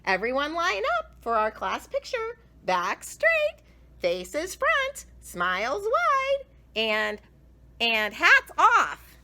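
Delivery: high, loud teacher orders; line them up like a sing-song teacher; bossy teacher call ending slow and loud; cheery teacher. A narrator's reading. Teacher instructing class.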